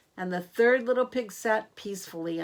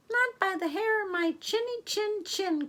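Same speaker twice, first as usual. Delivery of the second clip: high, tiny pig voice, much higher; squeaky little pig crying out; 12 semitones higher than usual. Acted. Third little pig refusing the wolf, in a high squeaky pig voice. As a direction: pipe it up like a brave piglet. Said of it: "cried the third little pig".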